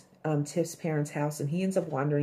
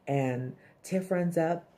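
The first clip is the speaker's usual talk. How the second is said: ordinary talk, nothing marked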